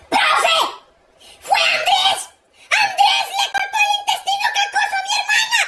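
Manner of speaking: high-pitched mock-hysterical cry; frantic tattling. Boy tells teacher on Andrés, frantic wail. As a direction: cry out the accusation in panic.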